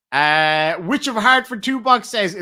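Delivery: ordinary talk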